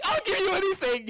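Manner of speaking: high, gushing father's promise; doting dad gush. Retold or imitated. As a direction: melt and promise him the world. Doting father answers his son.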